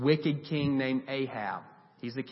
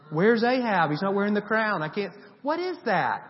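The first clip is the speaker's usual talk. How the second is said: mock search; 6 semitones higher, 3 dB louder than usual. Mocking God searching for Ahab.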